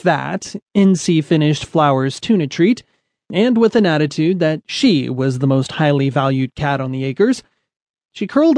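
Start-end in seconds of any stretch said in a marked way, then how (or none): none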